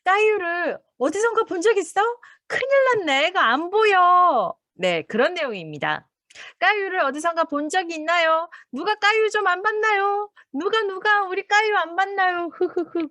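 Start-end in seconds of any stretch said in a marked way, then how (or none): 0.0-4.5 put-on high, pleading call for the lost child; worried mother. 6.6-13.1 high, far-off pleading voice; hammed-up worried neighbour; pleading searcher. Acting a frantic search.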